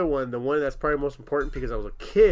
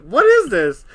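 higher, louder, incredulous question; mock shock; 8 semitones higher, 13 dB louder, slower than usual. Retelling his shock at the movie ending, louder. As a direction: replay the disbelief.

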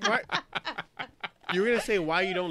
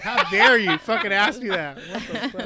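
mock outrage; 4 semitones higher, 7 dB louder, slower than usual. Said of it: "I was just so upset".

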